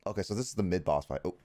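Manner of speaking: usual speaking voice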